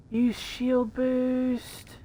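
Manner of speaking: slow, drawn out. Real, not acted. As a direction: stretch each word out slowly. Repeating a voice command slowly.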